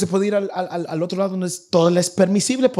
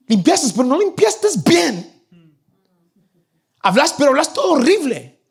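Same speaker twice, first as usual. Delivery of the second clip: high, loud scolding parent voice; exasperated parent nitpicking everything; nagging parent; 9 semitones higher, 5 dB louder than usual. Retold or imitated. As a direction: scold the child over every chore, pitched up and loud. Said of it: "De solo que les digan".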